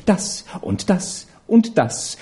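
slow teasing kisses; playful. Acted. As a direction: dole them out slowly. Kisses, slow, far timbre. (verspielt, langsam)

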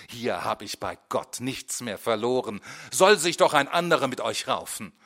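building to a loud shout; angry outburst. A narrator's reading. Teacher telling the class he quits, shouting.

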